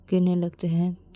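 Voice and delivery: ordinary delivery, nothing marked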